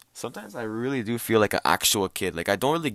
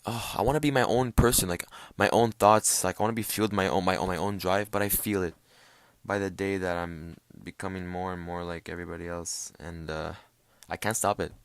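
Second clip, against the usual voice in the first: ordinary talk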